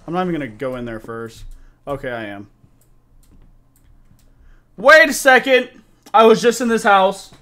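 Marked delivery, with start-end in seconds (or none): 4.8-7.3 much higher, loud realization; surprised shout. Real, not acted. Sudden realization in game.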